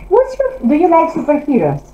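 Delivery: ordinary talk, nothing marked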